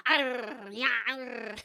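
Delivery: small creature's mock snarl; playful growl. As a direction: growl like a tiny beast chomping. Mimics small creature's powerful jaws.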